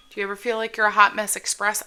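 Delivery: usual speaking voice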